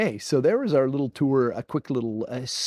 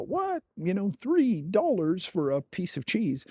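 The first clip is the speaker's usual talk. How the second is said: high-pitched incredulous What, then a slower, disbelieving question; mock shocked viewer; 7 semitones higher than usual. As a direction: play a stunned viewer balking at the price. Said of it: "you're gonna go".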